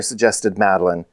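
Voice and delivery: plain reading voice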